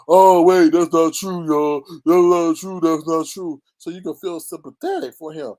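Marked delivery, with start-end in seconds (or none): 0.1-3.6 loud, pleading mock denial; mocking whiny denial. Vlogger mocking a streamer's loud denials. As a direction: ham up a cornered man's protest. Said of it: "he can just hop back and be like".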